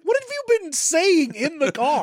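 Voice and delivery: incredulous. Retold or imitated. Incredulous passenger quote.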